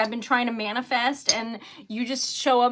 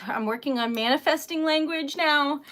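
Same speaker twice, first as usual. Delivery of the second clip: ordinary delivery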